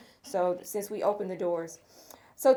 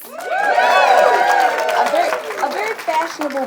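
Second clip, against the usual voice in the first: much higher, very slow and drawn out; playful gush; 14 semitones higher, 10 dB louder, slower than usual. Real, not acted. Host teasing a fashionable sponsor group, drawn out gush. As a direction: savor the compliment teasingly.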